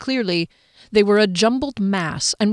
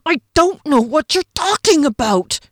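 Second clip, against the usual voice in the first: higher, louder denial forced through clenched teeth; furious high scream, teeth clenched; screamed through gritted teeth; 8 semitones higher, 6 dB louder than usual. A narrator's reading. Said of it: "Kier screamed at him through gritted teeth".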